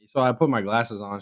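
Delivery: ordinary talk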